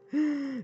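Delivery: put-on sharp startled gasp at her own accent; half-joking fearful gasp; mock gasp. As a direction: gasp as if hearing something scary. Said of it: "j'ai peur".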